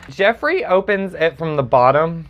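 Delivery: usual speaking voice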